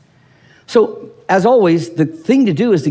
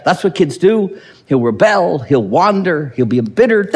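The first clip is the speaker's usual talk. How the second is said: ordinary talk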